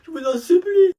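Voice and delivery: high, whining plea; begging. Acted. Pupil pleading with the teacher, high whine. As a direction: beg desperately.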